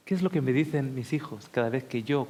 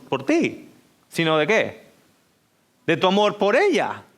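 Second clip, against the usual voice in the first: usual speaking voice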